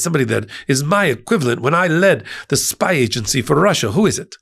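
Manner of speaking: a Boris and Natasha Russian accent, deliberately bad. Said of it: "I'm gonna do a really bad stereotypical Russian accent from Boris and Natasha days".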